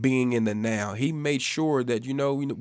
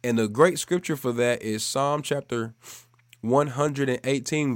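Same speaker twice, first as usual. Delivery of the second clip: ordinary talk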